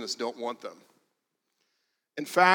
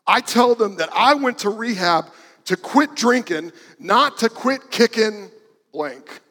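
higher and louder, a hammed-up furious tirade; imitating a fuming rant; 6 semitones higher, 7 dB louder than usual. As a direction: do her as an ornery old woman blowing her top. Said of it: "she's fuming mad".

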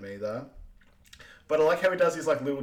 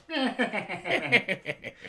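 breathy little giggles; imitating a laugh; 9 semitones higher than usual. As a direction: copy his silly little laugh. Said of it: "he does his, like, little laughs".

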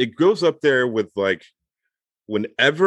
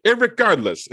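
high mock tough-guy "Irregardless"; Paulie Walnuts; 10 semitones higher than usual. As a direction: imitando Paulie Walnuts dizendo "Irregardless" bem mais agudo. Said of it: "Paulie Walnuts on The Sopranos would say".

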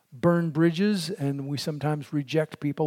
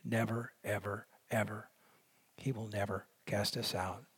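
"Never, ever, ever" sinking much softer and lower; intense hush; 6 semitones lower, 11 dB quieter than usual. Real, not acted. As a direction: drop to an awed, quiet insistence. Emphatic repetition dropping to hush.

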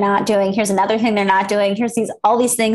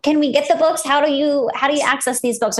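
excited; 6 semitones higher than usual. Real, not acted. Host eager about books.

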